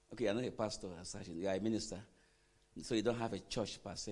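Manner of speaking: ordinary talk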